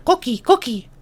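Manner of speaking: put-on very high baby voice; toddler. Toddler wanting a cookie, very high small voice. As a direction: chirp it like a tiny child. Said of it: "the human toddler says".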